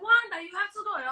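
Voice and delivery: a doctor at work urging Wanda, high and insistent. Retold or imitated. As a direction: push her hard, pitched high. Doctors pressing her to abort.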